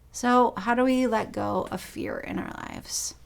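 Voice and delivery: normal talking voice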